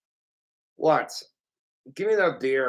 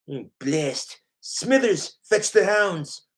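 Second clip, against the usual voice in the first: Simpsons boss; slower than usual. Acted. Boss orders Smithers to fetch hounds, imperious villain voice. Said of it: "My Simpsons voices".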